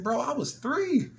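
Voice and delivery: high, slow, breaking into laughter; can't hold back the laugh; laughing disbelief. Retold or imitated. Realizing he'd been jailed since birth.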